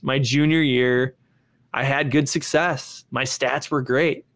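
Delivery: usual speaking voice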